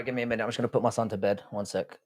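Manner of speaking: usual speaking voice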